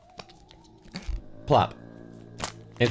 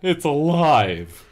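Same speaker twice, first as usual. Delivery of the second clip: slow, louder horror; horrified scream; 3 semitones higher, 5 dB louder, much slower than usual. Acted. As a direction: stretch out the terror. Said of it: "Andy screamed".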